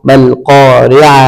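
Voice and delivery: slow, drawn-out syllable blending; careful teacher's slow spelling-out. Shown as a demonstration. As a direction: join the syllables slowly for learners. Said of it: "এটা ভালো করে খেয়াল করবেন".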